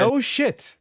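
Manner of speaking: exasperated outburst; incredulous no shit. Real, not acted. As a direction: blurt it in disbelief. Exasperated reaction.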